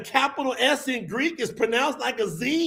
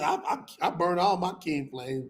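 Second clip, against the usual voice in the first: soft mock stammer; 7 semitones lower, 6 dB quieter than usual. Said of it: "She said".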